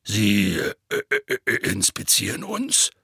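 stammering, baffled question; taken aback. A narrator's reading. Hagrid learns he will be inspected, stammering and baffled. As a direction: stumble over it in disbelief. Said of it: "erwiderte Hagrid verdutzt".

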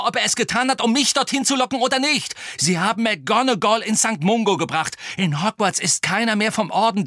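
high, urgent outburst as Harry; heated argument. A narrator's reading. Harry arguing to save Sirius, heated and urgent. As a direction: argue it hotly, pitch pushed up. Harry's acted quarrel with Hermine.

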